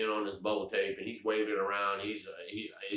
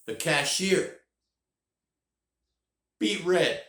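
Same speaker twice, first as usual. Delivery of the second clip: excited telling; 9 semitones higher, 7 dB louder, slower than usual. Real, not acted. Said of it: "excited storytelling".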